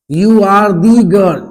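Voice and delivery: slow demo, THE stressed as 'dee'; emphatic THE. Shown as a demonstration. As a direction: say THE as a strong 'dee' before girl. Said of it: "consonant के पहले भी दी पढ़ा जाता है।". (THE पर बल देकर 'दी')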